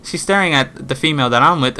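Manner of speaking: ordinary talk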